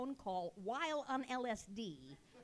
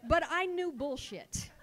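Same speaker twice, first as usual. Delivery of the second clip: usual speaking voice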